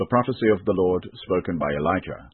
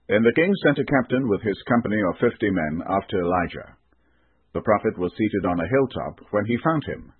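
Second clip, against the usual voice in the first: ordinary delivery, nothing marked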